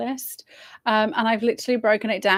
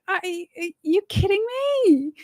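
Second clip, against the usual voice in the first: exasperated disbelief; 9 semitones higher than usual. Retold or imitated. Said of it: "And I was just like".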